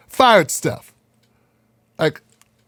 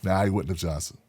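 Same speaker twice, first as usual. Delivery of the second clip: much softer, low aside; quiet aside; 12 semitones lower, 12 dB quieter than usual. Real, not acted. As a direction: mutter it aside. Aside to producer.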